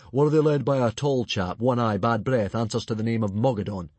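big bluff chieftain; booming chief. Acted. Stoic quizzing Alvin, loud and hearty.

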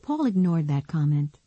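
plain reading voice

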